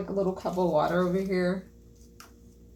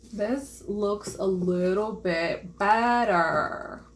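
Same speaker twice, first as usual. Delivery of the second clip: very slow, stretched-out delivery; slow, deliberate repeat with a long "better"; drawn out; much slower than usual. Real, not acted. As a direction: stretch every word, lingering on "better". Repeats it slowly for effect.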